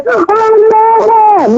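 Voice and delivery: a mock scream of terror, pitched high; imitating panicked crying. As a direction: cry out like people in a panic.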